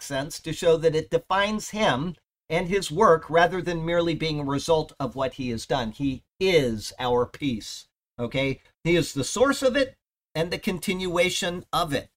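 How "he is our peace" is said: "is" pressed, words slow and spaced; stress on is. Shown as a demonstration. Preacher driving home that he is our peace. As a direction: draw out "he is our peace", weight on "is". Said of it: "Paul's words".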